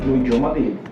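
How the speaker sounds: ordinary talk, nothing marked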